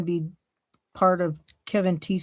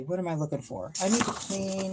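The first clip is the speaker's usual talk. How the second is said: usual speaking voice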